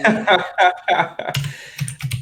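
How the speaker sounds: long, high burst of laughter; hearty laugh. Real, not acted. Friend mocks the apology, hearty laughter. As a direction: laugh helplessly at the joke.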